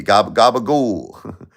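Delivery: drawn-out Jersey Italian "gabagool", stretched slowly; Tony Soprano gabagool. Retold or imitated. Thanking Tommy, he drops a slow Jersey Italian "gabagool". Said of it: "Tony Soprano".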